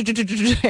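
repeats टु as if stuck on the word; stuttering demo. Shown as a demonstration. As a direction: acts out getting stuck on टु, टु, टु, टु. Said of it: "एक ही जैसा लग-, लगता है ना".

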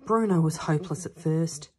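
ordinary delivery, nothing marked

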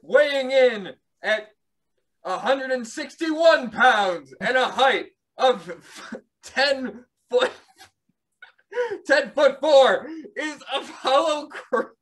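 booming boxing announcer drawing out the names; ring announcer. Acted. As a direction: announce the fighters like a big title bout.

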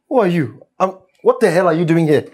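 startled, angry questioning; indignant challenge. Acted. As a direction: confront an intruder, loud and quick. Husband confronts stranger in house.

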